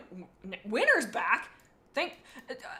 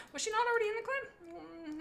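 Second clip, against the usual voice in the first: ordinary delivery, nothing marked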